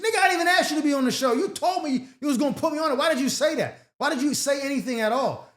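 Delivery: fast exasperated mock rant at a flaky friend. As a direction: fire it off like you're fed up.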